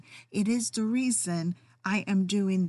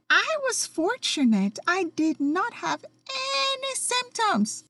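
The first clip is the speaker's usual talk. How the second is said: much higher, bubbly singsong greeting; cheerful Aunt Sonia; 11 semitones higher than usual. Retold or imitated. Quoting her cheerful aunt answering the phone, high and bubbly. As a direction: chirp it out like a sunny auntie. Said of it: "she really sounds like that cheerful character".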